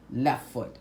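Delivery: plain reading voice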